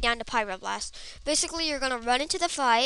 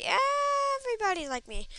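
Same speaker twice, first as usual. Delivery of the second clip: exaggerated insistence; 12 semitones higher than usual. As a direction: stretch it high, then slide down. Insisting everybody is like him.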